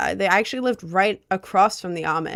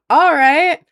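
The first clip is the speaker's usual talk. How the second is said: laughing; 7 semitones higher, 10 dB louder than usual. Real, not acted. Laughs at chat comment.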